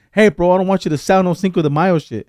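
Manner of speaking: mock demand. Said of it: "me going to Party City saying".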